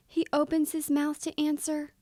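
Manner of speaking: usual speaking voice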